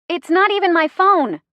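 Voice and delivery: high, loud protest; defensive. A narrator's reading. Driver scolded about dead phone, defensive. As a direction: protest it, pitched right up. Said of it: "my voice defensive".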